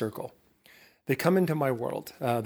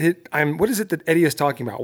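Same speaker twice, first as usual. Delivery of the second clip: ordinary talk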